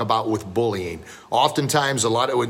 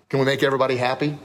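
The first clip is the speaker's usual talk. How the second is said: normal talking voice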